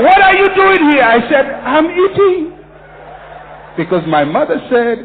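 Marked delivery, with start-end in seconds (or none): 0.0-1.0 loud, high-pitched imitation of an outraged challenger; acted shout, pitched way up; indignant challenge. Voicing someone catching him eating. 1.6-2.6 high, loud acted comeback; re-enacted shout, pitched way up; shouted retort. Acted answer to the challenger.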